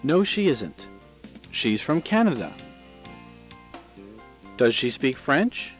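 ordinary delivery

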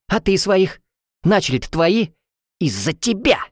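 high, spiteful accusations; spiteful hiss. A narrator's reading. Rival answers heroine during brawl, spiteful. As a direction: throw the blame back venomously. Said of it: "шипела она".

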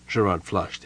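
plain reading voice

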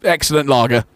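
hammed-up DJ voice ordering a pint; radio dj voice. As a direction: ham it up like a radio DJ. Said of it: "just everywhere".

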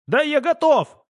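high, loud impatient answer; strained high-pitched shout; agitated retort. A narrator's reading. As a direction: yell back that you are ready. Pilot answers under strain.

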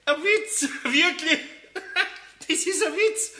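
can hardly speak for laughing; gleeful laughing. Real, not acted. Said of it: "Was gibt's denn da zu lachen?".

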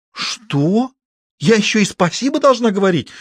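high, outraged disbelief. A narrator's reading. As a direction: scoff in offence. Sister outraged at being told.

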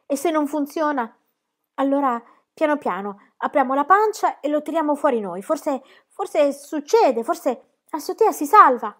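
put-on a sobbing boy clinging to hope; crying. A narrator's reading. Shepherd boy weeps over pregnant woman, tearful pleading.

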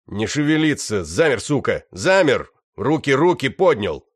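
loud commanding yell; shouting orders. Acted. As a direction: bark orders at a prisoner. Боец орёт на пленного. (громкий приказной крик)

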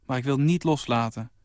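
ordinary delivery

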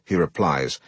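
plain reading voice

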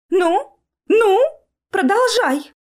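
high, eager prodding; impatient urging. A narrator's reading. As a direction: push him on in sharp high bursts. Joplin impatiently urges entranced George on. (нетерпеливое понукание высоким голосом)

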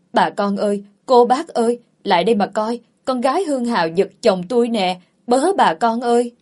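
loud, high hue and cry; calling out. Acted. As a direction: call the whole neighbourhood over to witness. Threatens to raise outcry, calls neighbours.

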